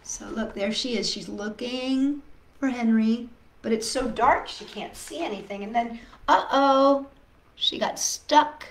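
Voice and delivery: plain reading voice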